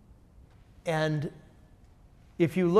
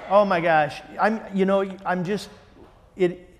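real delight; 3 semitones higher, 5 dB louder than usual. Real, not acted. Delighted by the Greek meaning.

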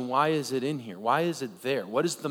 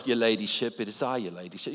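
mock British; 4 semitones lower, 3 dB quieter, faster than usual. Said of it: "It definitely sounds more British".

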